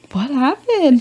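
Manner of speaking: high, swooping question; retold exaggerated surprise; mock bewilderment. Reacting once the confrontation is over, mock bewildered.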